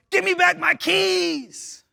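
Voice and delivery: loud, high drawn-out shout; yell. Retold or imitated. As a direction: yell it slowly, stretching every word high. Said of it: "I just yelled".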